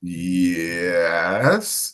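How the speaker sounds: low, soft, drawn-out yes rising at the end; retold wary reply. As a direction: stretch it into a hesitant question. Wary reply to stranger who recognises him.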